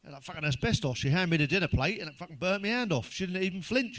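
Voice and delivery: usual speaking voice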